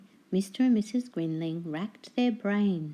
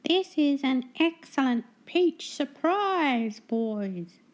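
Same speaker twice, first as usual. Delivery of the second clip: high squawky gull, pleased with itself; greedy seagull voice; 8 semitones higher, 4 dB louder, slower than usual. Acted. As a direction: gloat like a gull with a full beak. Seagull voices enjoying stolen lunch.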